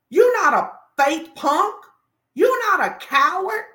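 escalating pulpit cry; rising preacher shout. Real, not acted. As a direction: hammer it home with mounting force.

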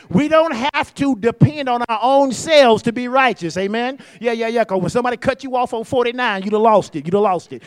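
loud, driving preacher's call, rushing in places; full-force preaching. Acted. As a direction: preach it hard to the room.

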